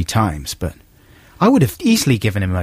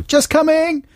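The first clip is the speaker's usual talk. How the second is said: high, sing-out answer to a call; called-out reply; slower than usual. Shown as a demonstration. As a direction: call back high, as if across a public space. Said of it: "they go".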